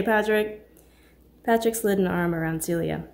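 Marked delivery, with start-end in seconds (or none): 0.0-0.7 friendly call. Acted. Friend at the door calls out Patrick in a loud greeting. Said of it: "Mark's friendly voice emanated".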